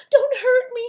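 high, loud, terrified whimper; frightened lion whimpering at the mouse; whimpering plea. Acted. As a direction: beg with a crying whimper. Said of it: "he whimpered".

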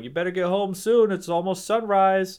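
high, loud put-on voice of a passerby; gruff stranger voice. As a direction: warn him like a gruff stranger. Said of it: "the guy's like".

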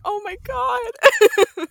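a long, high, swooning Oh my God; drawn out gush. Retold or imitated. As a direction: melt over how cute they are. Retold reaction to the kids.

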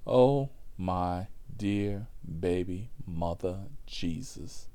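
slow, spaced-out dismay; stunned slow delivery. Retold or imitated. Driver reacting to the Jesus CD, slow mock dismay. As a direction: space out each word in disbelief.

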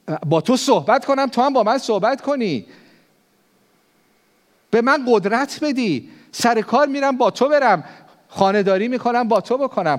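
high, loud impassioned preaching prayer; fervent prayer. Acted. Acted prayer at full preaching force.

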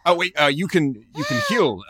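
high, loud sudden alarm. Acted. Player suddenly realising a friend can be healed, alarmed. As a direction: blurt it out in a panic. Sudden worry over dying ally.

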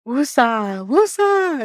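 calming mantra jumping up high; mock calm-down mantra with a laugh; self-soothing woosah. Shown as a demonstration. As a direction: chant it to keep your temper down, pitch rising on the repeat. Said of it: "just retelling this story is like".

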